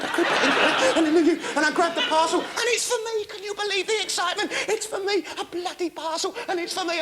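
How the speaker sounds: high, thrilled and rushing; giddy excitement. Retold or imitated. Telling the audience the parcel is his, giddy and high. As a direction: squeal with delight at your parcel.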